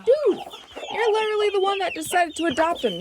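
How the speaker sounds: high, fast exasperated scold; shrill-free high scold at the hen. Real, not acted.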